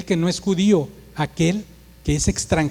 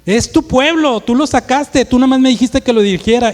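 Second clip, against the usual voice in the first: loud, higher-pitched indignant retort; heated Moses; 6 semitones higher, 5 dB louder than usual. Acted. Moses throws the blame back at God, heated and blunt. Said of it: "Empieza un arrebato".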